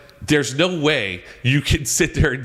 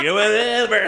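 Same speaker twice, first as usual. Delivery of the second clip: dismissive; 8 semitones higher, slower than usual. Retold or imitated. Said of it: "I was like".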